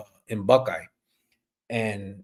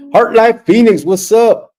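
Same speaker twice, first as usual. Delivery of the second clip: loud shout-out; 12 semitones higher, 11 dB louder, slower than usual. Real, not acted. Shout-out greeting to a viewer.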